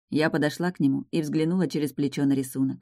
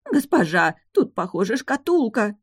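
higher, louder, rising to a shriek; hysterical cry; 6 semitones higher, 4 dB louder than usual. Acted. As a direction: shriek out the discovery. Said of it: "закричала няня истерически".

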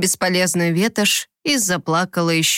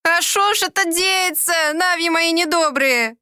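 put-on high tearful old woman's lament; crying old woman; 8 semitones higher than usual. A narrator's reading. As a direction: wail it like a weeping old crone. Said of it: "Горько плакала старая Улита". (плач старухи)

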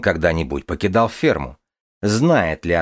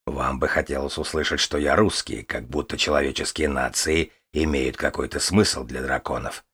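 low, softer dragon voice; calm dragon voice; 5 semitones lower, 4 dB quieter than usual. Acted. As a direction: speak as a calm, proud dragon. The dragon Kazimir speaks.

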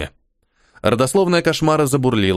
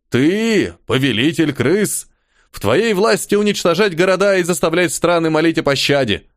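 stern elder charge; 6 semitones higher than usual. Acted.

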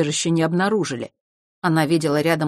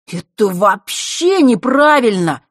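put-on loud, slow, outraged boy's voice; loud indignant protest; 5 semitones higher, 8 dB louder, slower than usual. A narrator's reading. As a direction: protest it loudly, drawing it out. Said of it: "громко возмущался Мишка".

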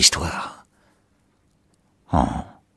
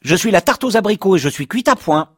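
voix de four très aiguë et criée; four qui crie; 14 semitones higher, 4 dB louder than usual. Retold or imitated. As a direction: imite un four criard, aigu. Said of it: "le four vous crie". (four qui claironne, voix très aiguë)

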